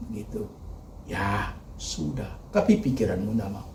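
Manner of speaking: ordinary talk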